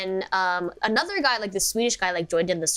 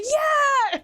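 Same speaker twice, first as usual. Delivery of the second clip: high, louder squeal of yeah; excited yeah; 4 dB louder than usual. Retold or imitated. Retelling her squeal about Given to a fellow fan, excited. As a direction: shriek it with delight, retold. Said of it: "I was like".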